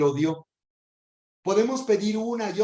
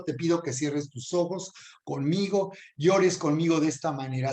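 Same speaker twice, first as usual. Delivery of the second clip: ordinary talk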